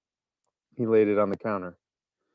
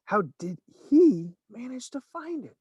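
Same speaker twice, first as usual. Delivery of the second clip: high voice from the crowd; 13 semitones higher than usual. A narrator's reading. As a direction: wonder aloud in the crowd. Crowd member wondering.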